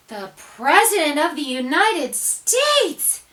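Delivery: slow, loud amazed announcement; crowd gasp. A narrator's reading. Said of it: "gasped the crowd".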